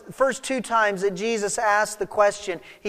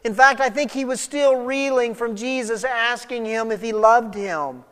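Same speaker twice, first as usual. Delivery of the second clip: ordinary talk, nothing marked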